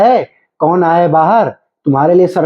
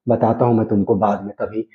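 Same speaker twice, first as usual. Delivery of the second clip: low, soft threat; threatening undertone; 8 semitones lower, 7 dB quieter than usual. Acted. Husband blaming wife for mother leaving, quietly threatening. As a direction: vow payback under your breath, pitch dropped.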